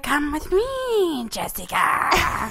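slow, calm creepy doll voice; too calm creepy doll. Retold or imitated. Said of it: "she's like".

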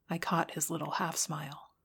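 plain reading voice

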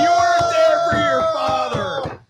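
high mock yell at his son; mock angry yell. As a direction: yell it like an offended father. Mock outraged dad yelling.

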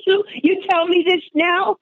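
high, laughing mock outrage; laughing disbelief. Retold shock at hidden charge cards.

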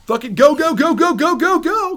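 high, loud hyped chant of go; rally everyone onward; pumped up. Retold or imitated. Singer hyping himself toward the new Danzig band. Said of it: "I was like".

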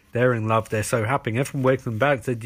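ordinary delivery

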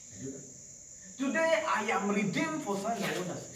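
normal talking voice

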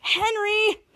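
high, drawn-out name in mild protest; upset exclamation. Retold or imitated. Girl about to get upset calls out to Henry, upset exclamation.

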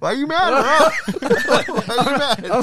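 teasing through laughter; laughing. Real, not acted. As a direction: laugh while you tease. Teasing friend while laughing.

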